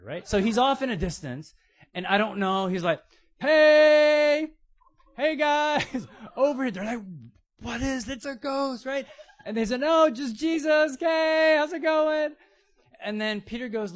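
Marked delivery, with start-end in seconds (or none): 3.4-6.7 high, loud call across the water. Retold or imitated. Jesus calling from afar to disciples. 7.6-8.8 scared disciples. Retold or imitated. Disciples frightened on the boat. 9.8-12.3 high, loud cheerful shout; calling out. Retold or imitated. Jesus calling across the storm.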